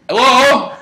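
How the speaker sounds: high, loud "hello" to an intruder; calling out. Retold or imitated. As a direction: call out sharply towards the door.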